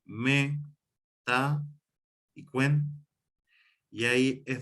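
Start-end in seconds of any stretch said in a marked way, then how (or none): 0.0-3.1 "lo, me, ta y cuen" with a pause after each; "lo, me, ta y cuen" spaced out for a class; syllable by syllable. Shown as a demonstration. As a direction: count out "lo", "me", "ta", "cuen" as separate syllables. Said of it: "la sílaba lo".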